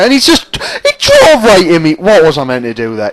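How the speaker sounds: high, loud cry of protest; outraged yell. Real, not acted. Gamer protesting after a rival drove into him, outraged yelling. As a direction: yell in disbelief at being hit. Rammed by another car mid-race.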